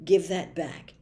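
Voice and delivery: usual speaking voice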